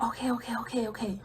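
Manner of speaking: quick hushed agreement; whispered. Retold or imitated. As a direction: whisper it nervously. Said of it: "we whispering".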